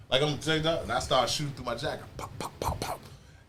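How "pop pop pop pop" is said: soft unvoiced pop pop pop; mock gunshots. Robbery story, imitating gunshots quietly. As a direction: mouth the shots under your breath.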